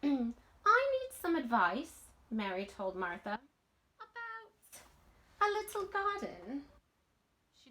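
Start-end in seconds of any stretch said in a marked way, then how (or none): 0.0-2.1 put-on lightly raised, slow girl's voice; a hint of an eager young girl; Mary's voice. Mary asks Martha for advice. 4.0-6.6 put-on much higher, girlish and careful; a light girl's voice holding back a secret; Mary's voice. Mary hints at her secret.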